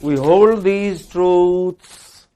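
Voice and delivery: slow and grand, truths drawn out; we hold these truths, slowly and loudly; stretched declamation. Shown as a demonstration. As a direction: recite it like a proclamation. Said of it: "It's a very long vowel".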